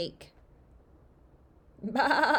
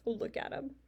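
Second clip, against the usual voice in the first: ordinary delivery, nothing marked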